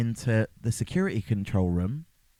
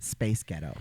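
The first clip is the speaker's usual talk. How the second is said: American accent; 7 semitones lower, 4 dB quieter than usual. Shown as a demonstration. Host shows co-host the trick, American accent.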